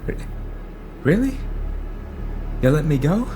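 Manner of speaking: high, incredulous questioning; shaken disbelief. Acted. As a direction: ask nervously, hardly believing your luck. Driver disbelieving at being let go.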